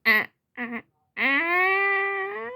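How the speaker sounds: staccato fake laugh rising high; put-on villain cackle; Joker. Shown as a demonstration. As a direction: laugh in short bursts, then one long high note. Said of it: "his laugh".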